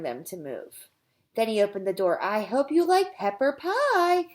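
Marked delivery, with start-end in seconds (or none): 2.2-4.3 high, loud, slow gloating voice; sly menacing cook. Acted. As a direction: savour the trap, slowly. Miss Mush springs her trap.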